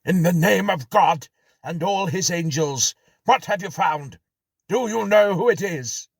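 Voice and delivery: very high, loud, wild howl; roaring ghost. Acted. Dead Smithers roaring up from below, desperate howl. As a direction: roar it up from the grave below. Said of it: "came roaring up from the room below".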